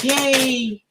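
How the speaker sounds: a high little Yay; mock cheer. Reader acting out her excited self, mock cheer. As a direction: pretend to cheer. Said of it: "Or I'd be like".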